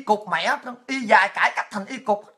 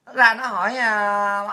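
ordinary delivery

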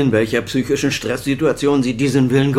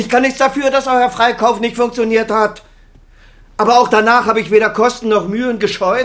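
much higher and louder, furious self-defence; angry shout; 11 semitones higher, 6 dB louder than usual. Acted. Father shouting back at his accusing son, furious. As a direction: yell it back, stung by the accusation.

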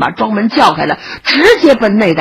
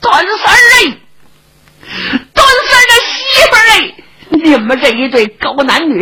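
shrill, much higher and louder crying rage; grieving old woman yelling; 12 semitones higher, 4 dB louder than usual. Acted. Old mother cursing her daughter's killer, sobbing furious shouting. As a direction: scream curses through tears. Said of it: "跟着就听见赵老太太喊".

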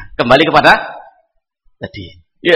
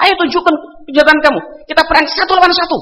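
retold challenge; 7 semitones higher, faster than usual.

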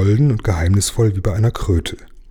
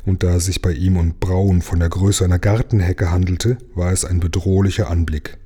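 usual speaking voice

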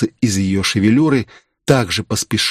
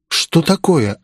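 higher, urgent question; alarmed; 7 semitones higher, slower than usual. A narrator's reading. Girl running up to the man, alarmed. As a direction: demand to know what happened, alarmed.

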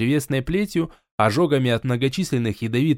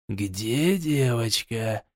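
slow, low, furious hushed threat; whisper yelling; 4 semitones lower, 4 dB quieter, much slower than usual. Acted. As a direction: hiss the question in quiet rage. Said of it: "яростно прошептал волшебник".

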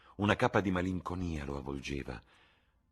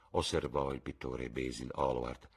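ordinary delivery

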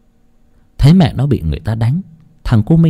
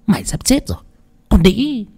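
menacing threat; 8 semitones higher than usual. A narrator's reading. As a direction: sneer it close to her ear. Villainess threatens Lan.

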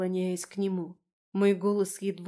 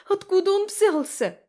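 high, loud frantic questioning; panicked demand; 11 semitones higher, 7 dB louder than usual. Acted. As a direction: demand answers in a rising panic. Friend panics, grabbing her arm.

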